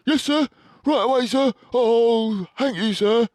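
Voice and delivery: keen to obey; eager young badger. A narrator's reading.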